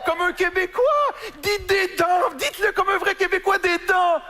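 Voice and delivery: high, insistent shouted-out coaching; mocking Parisian demand. Parisian demanding a real Québécois accent, high and insistent. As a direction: push it high and hard, egging someone on. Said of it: "c'est les arriérés mentaux qui font comme ça".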